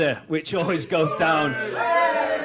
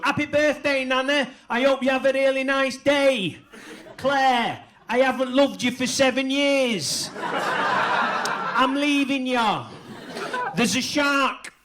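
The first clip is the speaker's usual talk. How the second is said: Mancunian monotone; 5 semitones higher, slower than usual. Retold or imitated. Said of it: "it's still at the Mancunian monotone".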